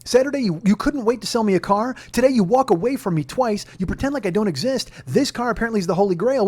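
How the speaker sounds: high, loud, emphatic retold confrontation; forceful voice. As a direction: stand your ground, indignant but not screaming. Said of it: "I had bass in my fucking voice".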